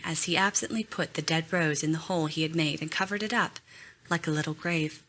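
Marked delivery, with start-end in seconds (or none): none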